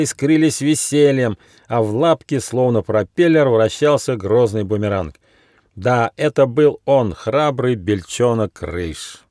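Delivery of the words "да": usual speaking voice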